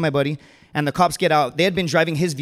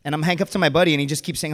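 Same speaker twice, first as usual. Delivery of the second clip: usual speaking voice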